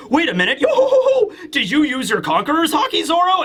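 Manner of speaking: laughing, high squeak of surprise on yo; chuckling disbelief, jumping up in pitch; surprised Brook. Retold or imitated. Brook startled at Zoro during the festival, laughing surprise. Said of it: "Brook is like".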